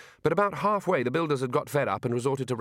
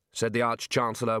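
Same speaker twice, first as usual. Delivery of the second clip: ordinary delivery, nothing marked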